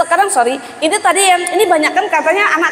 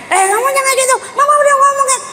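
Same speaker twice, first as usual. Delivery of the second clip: high, shouted nagging mom; mock scolding mother; 10 semitones higher, faster than usual. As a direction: play the shrill scolding mother, hammed up. Mama memarahi anak, menirukan. (ibu yang mengomel keras, dibuat-buat)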